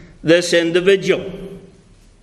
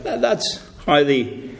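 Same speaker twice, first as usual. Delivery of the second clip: ordinary delivery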